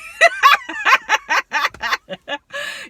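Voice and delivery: cracking up at the joke; squealing laughter; high laugh. Real, not acted. Laughs at her own line.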